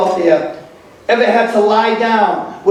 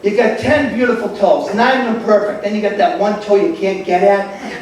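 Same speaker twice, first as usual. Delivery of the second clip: normal talking voice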